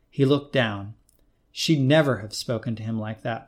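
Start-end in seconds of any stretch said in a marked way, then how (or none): none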